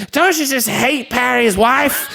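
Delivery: high, slow comic drawl; drunk Arthur imitation. Drunk asking Susan's dad, in a slow high imitation.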